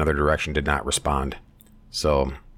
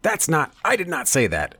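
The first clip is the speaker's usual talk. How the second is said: high indignant denial, imitated; mock Biden firing back a denial; retold angry denial; imitating Biden snapping back; 10 semitones higher than usual. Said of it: "Biden shot back".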